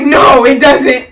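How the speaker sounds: loud, slow, flat-out denial; mock shout. Julia at the council meeting, snapping back loudly. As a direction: slam it down, slowly and loud. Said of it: "she was like".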